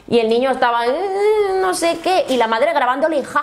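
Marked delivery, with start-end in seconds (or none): 0.9-2.2 put-on whiny child. Voicing the fretful child.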